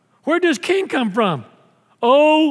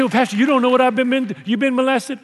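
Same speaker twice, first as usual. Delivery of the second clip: imitated objection; 4 semitones higher, faster than usual. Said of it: "You say".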